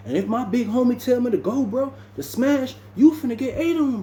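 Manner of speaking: high retold voice, lightly performed; retold threat. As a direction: pitch the quote way up, acting him out. Voicing Sid's threat in story.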